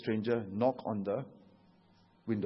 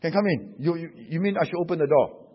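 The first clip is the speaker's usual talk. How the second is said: ordinary delivery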